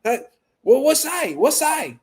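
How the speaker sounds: high put-on voice; high impatient order-taker voice; impression of takeout lady. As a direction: mimic her asking what side. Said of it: "I'm like".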